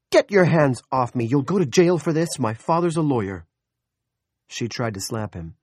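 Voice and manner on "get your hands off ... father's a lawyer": shrill, furious yell at the intruders; angry shouting. Acted. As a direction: scream it in outrage. Woman yelling at intruders.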